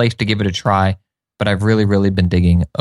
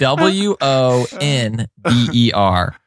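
very slow, letter by letter; slow spelling out; much slower than usual. Shown as a demonstration. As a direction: drag out each letter. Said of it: "spelling again".

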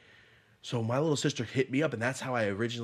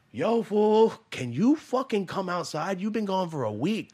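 loud and much higher, yelling; calling out; 10 semitones higher, 7 dB louder than usual. Retold or imitated. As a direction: shout toward the house. Calling dad to come outside.

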